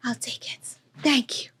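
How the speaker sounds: soft aside whisper. Real, not acted. Quietly taking a napkin from her producer, whispered. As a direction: breathe it off-mic.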